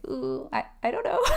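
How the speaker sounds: put-on squirm of uncertainty; hesitant, uneasy reply. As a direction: stall awkwardly, voice climbing high. Acting out a client put on the spot.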